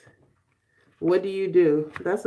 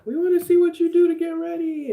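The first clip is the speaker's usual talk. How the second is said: higher, louder playful voice for the viewers asking; mock viewers' voice; 9 semitones higher, 6 dB louder than usual. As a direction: quote the fans as a pushy little chorus. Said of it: "a few people were like".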